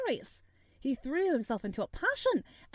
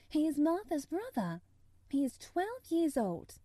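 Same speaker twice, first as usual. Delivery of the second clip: put-on higher-pitched girl explaining; child character, pitched up and earnest; Mary, eager; 6 semitones higher than usual. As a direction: explain it in a girl's lifted voice. Voices Mary describing Dickon.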